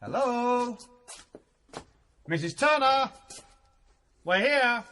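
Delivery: calling into a cavernous hall. Acted. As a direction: call out for the housekeeper. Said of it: "Henry's voice echoed around the dark".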